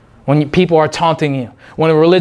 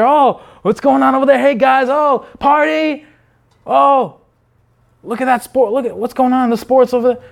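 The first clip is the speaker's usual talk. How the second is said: distracted wanderer; 6 semitones higher than usual. Distracted believer acted out.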